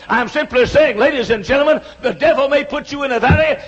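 loud, rising shout of rejoicing; preaching at full force. Acted. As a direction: lift the congregation up. Closing rally over music.

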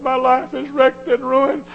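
high, loud anguished testimony; testifying young man. Retold or imitated. Preacher voicing a young man confessing, high and anguished. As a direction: cry it out like a broken young man.

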